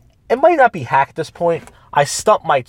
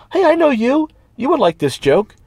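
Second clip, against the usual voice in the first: much higher, overly cheery hello; fake friendly greeting; 10 semitones higher than usual. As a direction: greet him like an old pal you snubbed. Voicing the fake-friendly comedian.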